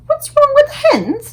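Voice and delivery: a queen demanding an answer; indignant Queen. A narrator's reading. The Queen demanding of the butler, high and slow.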